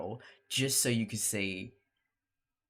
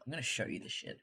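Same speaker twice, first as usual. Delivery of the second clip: ordinary talk, nothing marked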